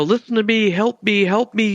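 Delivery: high, mock desperate plea; half-shouted impression of a trapped man; imitated yelling out. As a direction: call out for help, pitched well up. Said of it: "yelling out to the zom".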